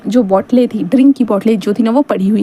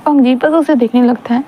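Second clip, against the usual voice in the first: ordinary delivery, nothing marked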